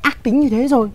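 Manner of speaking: urgent warning. Acted. Servant urgently warning the girl they'll be found out. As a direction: press the danger on her.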